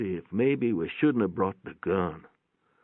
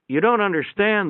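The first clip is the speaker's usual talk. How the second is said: the nurse, urgent and raised, higher and louder; 11 semitones higher, 5 dB louder than usual. A narrator's reading. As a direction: cut in to correct him, pitched up. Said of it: "the nurse said".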